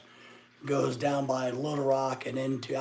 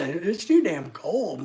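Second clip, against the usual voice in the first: slow, loud complaint; 3 semitones higher, 7 dB louder, slower than usual. Real, not acted. Complaining about the cold.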